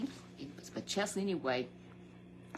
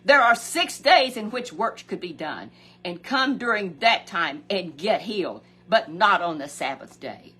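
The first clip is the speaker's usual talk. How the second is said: stern official; 9 dB louder than usual. Retold or imitated. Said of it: "Instead, he said".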